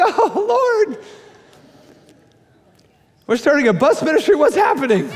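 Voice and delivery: high fast laughing dismay; overwhelmed laughter. Retold or imitated. Pastor hearing neighbors will come, laughing dismay. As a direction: laugh in mock panic.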